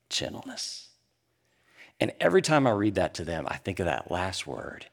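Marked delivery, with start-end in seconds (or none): 0.1-0.9 softly whispered. Acted. Reading scripture to sons.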